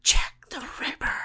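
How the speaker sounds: hushed dramatic reveal; spooky whisper. Pretend. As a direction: whisper the name like a horror sting.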